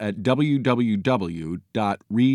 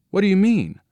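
high-pitched, baffled demand; startled boy; 10 semitones higher, faster than usual. A narrator's reading. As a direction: pitch it up, wanting an explanation.

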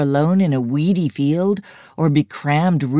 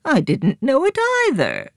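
crone replying; 12 semitones higher than usual. A narrator's reading. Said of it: "replied the crone".

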